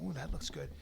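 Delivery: low, hushed tempted murmur; tempted aside. Retold or imitated. Passenger eyeing the offered ice cream, tempted and hushed. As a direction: murmur it softly to yourself.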